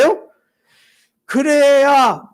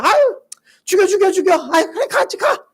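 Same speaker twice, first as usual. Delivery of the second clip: higher, mocking sneer; sneering sarcasm; 9 semitones higher than usual. Thomas mocking Jesus's call to die together, sneering higher. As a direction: sneer it cynically. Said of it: "비아냥거리는 듯이 비관적으로 삐딱한 느낌으로".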